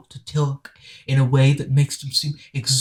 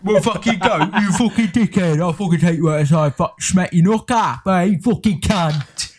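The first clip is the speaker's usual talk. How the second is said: put-on drunk-orc British yob, pitched up; rough British accent; 4 semitones higher, 4 dB louder than usual. Mimicking drunk Brits threatening a fight, rough mock British accent. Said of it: "sound like Warhammer orcs".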